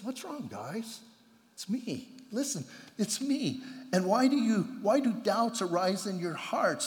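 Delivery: calm them like a friend; soft hushed Jesus comforting his friends; gentle reassurance. Retold or imitated. Jesus gently reassures frightened disciples.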